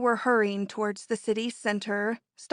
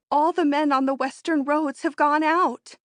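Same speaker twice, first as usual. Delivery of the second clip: loud and high with excitement; excited; 6 semitones higher, 5 dB louder than usual. Acted. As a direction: announce it breathlessly. Excited news of strike.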